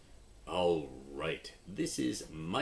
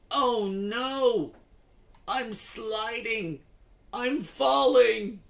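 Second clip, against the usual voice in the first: much higher, rising to a loud panicky cry; falling off the bike; 11 dB louder than usual. Acted. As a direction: yelp in alarm as the bike tips over. Mike falls off bike.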